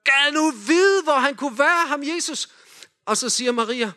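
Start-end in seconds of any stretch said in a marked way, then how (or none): 0.0-2.5 higher, slower, wondering voice; playful Josef. Acted. Leader voicing Josef wondering where Jesus is. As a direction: wonder aloud as a puzzled father. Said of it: "siger Josef".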